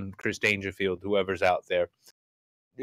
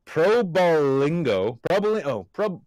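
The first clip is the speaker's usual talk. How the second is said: sounding out; 5 semitones higher, 5 dB louder than usual. Real, not acted. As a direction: sound out "Probolingo" at half speed, rising at the end. Sounding out a hard name.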